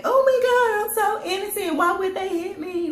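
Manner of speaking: high, put-on innocent whine; mock innocence. Mocking a bully who acts innocent, pitched high. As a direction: play the fake victim. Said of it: "you act".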